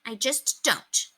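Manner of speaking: put-on slow, stubborn child; informing. A narrator's reading. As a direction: refuse stubbornly, slowly. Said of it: "Ramona informed him".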